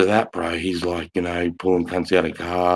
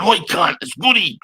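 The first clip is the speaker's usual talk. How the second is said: higher, urgent call nose to nose; holding a friend's face, urgently calling his name; 10 semitones higher than usual. Retold or imitated. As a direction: snap him out of it by name. Calling a friend back to reality.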